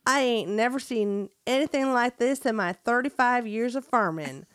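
folksy Southern farmer voice; hammed-up country farmer; best country accent. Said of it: "That was my best country accent".

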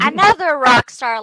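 loud, thrilled shout of praise; excited praise. Real, not acted. Host hailing the guest as a rock star, loud and thrilled.

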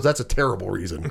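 low, quiet, dry put-down; deadpan Dewey. Retold or imitated. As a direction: drop the voice low and flat for a dry dismissal. Dewey deadpan refusal quoted.

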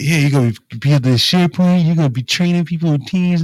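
mocking the boss. Retelling to friends how the boss pitched the job, mock-upbeat. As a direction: parrot the manager's empty promises, a touch hammed-up. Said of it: "you're like".

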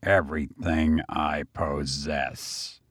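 slow, low and soft, each word weighed; slow answer. A narrator's reading. Brigand to his jailer, slow and grave. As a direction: answer slowly, meaning every word. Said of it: "Then he answered slowly".

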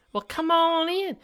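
very high, slow falsetto invitation; high syrupy welcome to the bear; mock nursing home worker. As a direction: stretch it out sweetly and creepily. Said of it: "such a Kamala Harris laugh".

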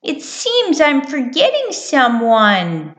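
high mouse voice, wondering aloud; puzzled little mouse. Acted. Mouse puzzles over her list.

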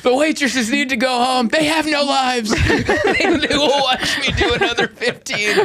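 high, laughing rant; mock desperate comic. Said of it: "I go".

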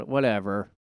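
ordinary talk

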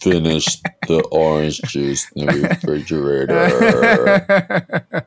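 deadpan Vader complaint, laughing; mock Darth Vader. Cohost continuing the Vader bit with a slow, low, laughing voice. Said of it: "that's Darth Vader".

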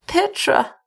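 high-pitched weakened woman; weak toneless murmur. A narrator's reading. Weakened wife calling her husband, feeble. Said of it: "her voice a toneless murmur".

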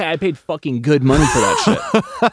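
jokey outrage; mock indignant scarecrow. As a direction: play the scarecrow as comically put out. Scarecrow jokingly tells off Dorothy.

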